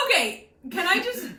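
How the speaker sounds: high, loud words squeezed out through laughing; can't stop laughing as she cuts in; laughing while interrupting; real laughter. Real, not acted. Laughing at friend's summary.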